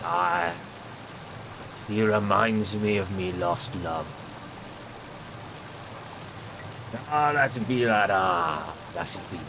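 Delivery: slow, lightly voiced old salt; a hint of a wistful sea dog; old sea captain. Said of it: "old-timey sea captain's voice".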